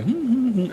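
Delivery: high, considering "hmm"; pondering hum. Retold or imitated. Father looking over the docks, pondering high hum.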